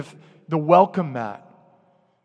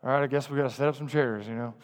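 fast, softer muttered complaint; mock grumble; 5 semitones lower, 8 dB quieter, faster than usual. Imitating a grudging churchgoer, he mutters softer and faster. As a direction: rush it out like a resentful chore. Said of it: "muttering things under your breath like".

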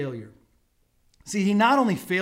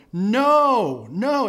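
loud, high-pitched shouted reply; drawn-out yelled refusal; yelling back; 6 semitones higher, 8 dB louder than usual. Retold or imitated. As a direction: holler the answer back across the water. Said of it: "they yell back to him".

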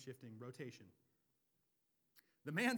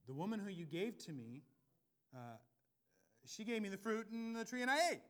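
louder, rising to high pitch; whiny blame; 5 semitones higher, 8 dB louder than usual. Acted. Adam shifting blame to God, building to a whine.

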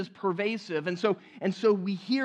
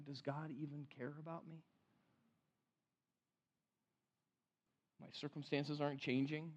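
much softer, lower, hopeless inner voice; quiet despair; 5 semitones lower, 11 dB quieter than usual. Retold or imitated. Preacher voicing a grieving believer's doubts, hushed and despairing. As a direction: let it sink into a hushed lament.

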